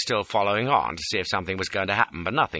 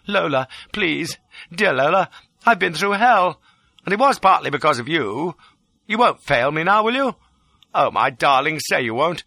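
mildly overwrought, raised-pitch appeal; distraught pleading; 6 semitones higher than usual. A narrator's reading. Fugitive imploring Lola not to fail him, pleading. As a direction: flatter and beg to win her over. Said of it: "The distraught pleading line".

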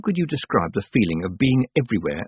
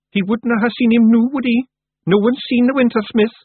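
much higher, louder little Scots pixie voice; a tiny Scots warrior setting it straight; Scottish Feegle; 6 dB louder than usual. Acted. Feegle Billy explains.

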